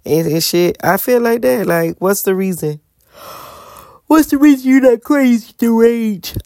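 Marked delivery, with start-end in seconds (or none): 4.1-6.4 raised, louder mock demand; out loud screaming. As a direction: belt it out in exasperation, half-screamed. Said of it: "out loud screaming to the top of the world".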